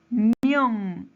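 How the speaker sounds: mión isolated, loud and drawn out; loud, stretched mión to show the stress; stressed syllable. Shown as a demonstration. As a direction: punch mión on its own and hold it. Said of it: "en la última sílaba".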